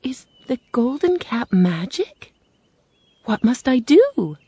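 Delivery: put-on high, questioning young girl voice; little girl, wondering; Dorothy. A narrator's reading. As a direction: ask in a lifted, girlish voice. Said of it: "the girl asked".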